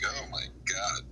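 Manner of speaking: ordinary delivery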